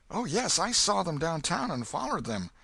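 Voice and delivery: put-on a frightened boy begging for secrecy; scared boy Huck. Huck begs the old man to keep his name secret, high and scared.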